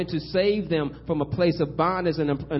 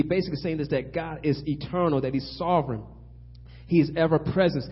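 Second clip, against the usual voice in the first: usual speaking voice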